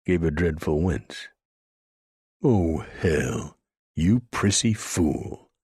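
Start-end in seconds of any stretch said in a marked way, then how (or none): 2.4-5.5 disgusted sneer, drawn out. Acted. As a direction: spit it out at the fussy fool. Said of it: "Frank said in disgust".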